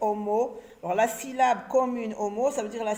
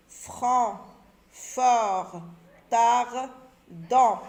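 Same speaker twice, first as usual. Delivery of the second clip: each word said slowly and alone, with a pause after it; slow reading drill; 5 dB louder, much slower than usual. Shown as a demonstration. Teacher reading words aloud to the children, slow and clear. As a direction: model the words one by one for the class.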